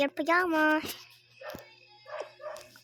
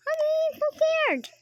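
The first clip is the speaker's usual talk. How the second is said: much higher, louder frightened cry; pretend scared; 13 semitones higher, 6 dB louder than usual. Pretend mom squeals to her child that she is scared.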